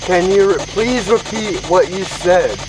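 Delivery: high, slow, pleading question; talking to a ghost. Real, not acted. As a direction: coax the spirit to speak again. Asking spirit to repeat.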